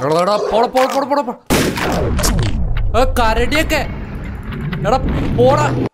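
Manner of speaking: high, loud frantic shouts to drive it off; panicked yelling. Real, not acted. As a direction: yell in real panic at the attacker. Attacked by dog and bear.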